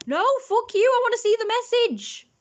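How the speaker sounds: very high, loud whiny shriek; squealing demand. Real, not acted. Streamer demanding to see the message, high shrieking whine.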